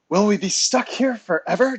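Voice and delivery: high, loud question with a touch of rawness; raw voice. A narrator's reading. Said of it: "her voice raw from the smoke".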